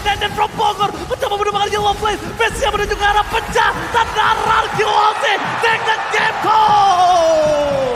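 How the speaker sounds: hype shout. Real, not acted. Casters calling the game win.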